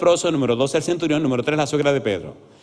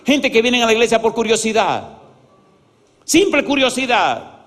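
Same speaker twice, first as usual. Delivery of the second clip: high, loud, driving sermon delivery; full-force preaching; 8 semitones higher, 5 dB louder than usual. Real, not acted. Pastor urges the congregation to walk close with God, preaching forcefully. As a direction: build to a raised, insistent proclamation.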